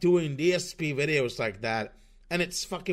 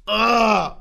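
loud exasperated groan; 7 semitones higher, 11 dB louder than usual. Real, not acted. Frustrated outburst after retort.

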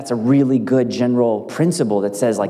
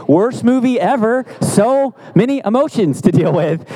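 mock outrage; 10 semitones higher, 3 dB louder than usual. Mock complaint about Pixar movie.

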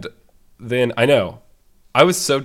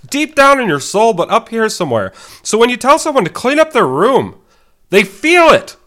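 much higher and louder, straining up top; high-register Jordan Peterson; 12 semitones higher, 10 dB louder than usual. Shown as a demonstration. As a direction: go way up there and land every word. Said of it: "I know it's in a higher register".

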